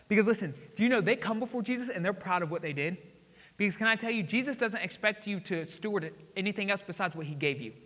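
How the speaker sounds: ordinary talk, nothing marked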